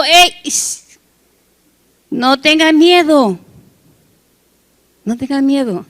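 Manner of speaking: slow, hushing reassurance to a frightened crowd; gentle shush then slow comfort; calming hush. Acted. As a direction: soothe them like Moses at the sea. Moses calms the frightened people.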